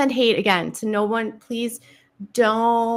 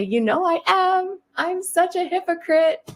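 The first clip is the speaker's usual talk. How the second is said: high, slower playful confession; high sing-song admission; 10 semitones higher than usual. Retold or imitated. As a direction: cheerfully own it in a high voice. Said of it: "And I'll say".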